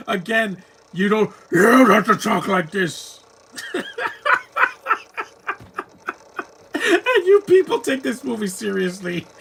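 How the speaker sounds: high, loud words through laughter; real laughing disbelief; mocking the movie through laughs; laughing while talking. Real, not acted.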